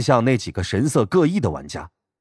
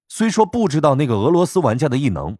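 usual speaking voice

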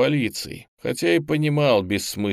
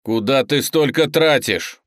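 loud, higher-pitched protest; indignant; 5 semitones higher, 6 dB louder than usual. A narrator's reading. Teacher scolding a student for wasting peroxide, indignant. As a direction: scold in raised, outraged voice. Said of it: "возмутился Юрий Эдуардович".

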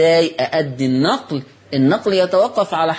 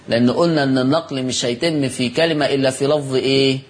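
ordinary talk

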